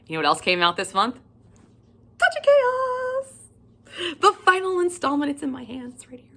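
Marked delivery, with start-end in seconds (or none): 2.2-5.3 high, loud, slow squeal of the title; excited squeal. Real, not acted. Squealing title of awaited book. 5.3-6.3 breathless reverent whisper; hushed whisper holding the book; excited whisper. Real, not acted. Whispering over book in hand.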